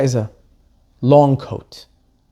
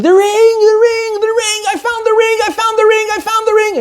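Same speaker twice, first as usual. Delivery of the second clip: much higher, ecstatic cry of discovery; excited screaming. Retold or imitated. Husband yelling from the bedroom to his wife, overjoyed. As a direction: shout it out, beside yourself with joy. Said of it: "Her husband is screaming".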